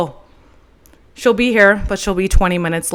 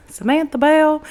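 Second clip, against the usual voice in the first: light Southern accent on "Samantha Bell", pitch higher; 7 semitones higher than usual. Shown as a demonstration. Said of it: "I could say it all the time in a Southern accent".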